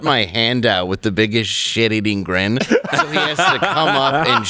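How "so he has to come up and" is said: laughing. Real, not acted. Gleeful retelling, laugh heard.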